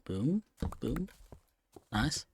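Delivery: usual speaking voice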